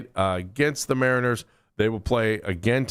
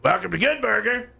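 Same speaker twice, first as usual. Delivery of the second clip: excited catchphrase; 10 semitones higher than usual. Retold or imitated. Quoting Good Burger catchphrase.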